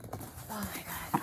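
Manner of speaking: hushed, breathy gasp of admiration; awed whisper. Real, not acted. Vlogger admiring her yarn for viewers, awed whisper. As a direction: let it slip out under your breath.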